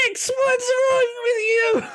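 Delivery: very high squeaky creature cry; panicky little lizard. Acted. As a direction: shriek it in a tiny lizard voice. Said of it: "there's a little lizard standing ... and it's going".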